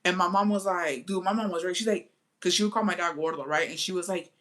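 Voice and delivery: usual speaking voice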